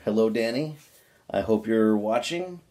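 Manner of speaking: usual speaking voice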